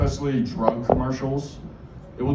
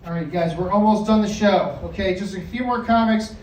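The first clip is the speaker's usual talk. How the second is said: emcee intro; 8 semitones higher than usual. Acted. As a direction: hype up the next comic like a club host. Host announcing the next comic.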